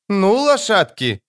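high, slow call to the horses; ringing slow cry; shout. A narrator's reading. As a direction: call out, drawn out. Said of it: "крикнула Искра".

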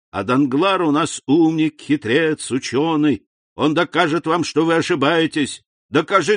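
high, loud tipsy enthusiasm; jovial drunk Caderousse. A narrator's reading. Drunk Caderousse toasting Dantès, boisterous and fond. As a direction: praise and toast like a merry drunkard.

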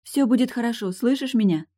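loud anxious comforting; urgent reassurance. A narrator's reading. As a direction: reassure someone who is fading, urgently. Eileen rousing and reassuring Tilda.

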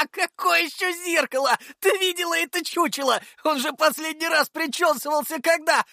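very high, helpless cackling speech; roaring with laughter. A narrator's reading. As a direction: talk through big acted guffaws. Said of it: "заставив Лешего ржать в голос".

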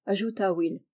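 plain reading voice

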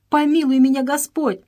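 high, loud plea to God; terrified plea. A narrator's reading. Stop, in terror, cries out high and loud to God. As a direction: beg heaven for mercy in panic.

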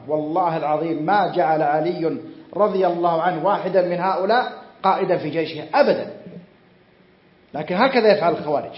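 normal talking voice

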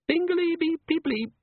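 very high, slow sing-song beeping; bright gadget beeps from an imp; electronic chime. Acted. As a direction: chirp it like a tiny device alarm.

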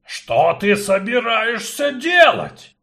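high, loud, slow dwarf voice; alarmed dwarf. Acted. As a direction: demand it, startled. Dwarf grips his axe.

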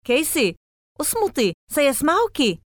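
high, tense hushed warning; urgent warning. A narrator's reading. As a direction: warn her urgently. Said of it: "قال لها ويل محذرا".

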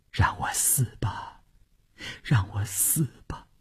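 breath-soft, yearning whisper; hushed private wish; whispered inner thought. A narrator's reading. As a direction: whisper it to yourself, overcome. Inner thought while held in embrace.

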